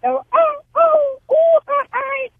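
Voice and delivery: high yelping cries of a madman; mock howling yells at a wall; retold yells. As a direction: yelp it out high like a lunatic. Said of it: "se avienta unos gritos".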